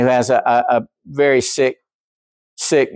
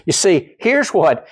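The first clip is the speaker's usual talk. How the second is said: ordinary talk, nothing marked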